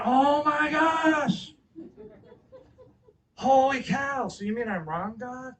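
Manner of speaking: loud, high, drawn-out exclamation; astonished outburst. Retold or imitated. Preacher replays his reply to God's answer, astonished. Acting out stunned reaction to God.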